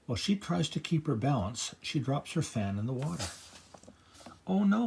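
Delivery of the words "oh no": high, loud oh no; a sharp, high-pitched cry of dismay. A narrator's reading. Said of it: "cries Sophia".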